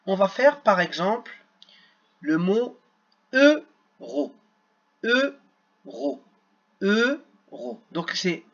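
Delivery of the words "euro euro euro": EURO in two separated syllables, "eu ... ro"; split into syllables. Shown as a demonstration. Teacher showing children the syllables, "euro" broken in two. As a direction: sound out "euro" slowly, pausing before "ro". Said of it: "les syllabes".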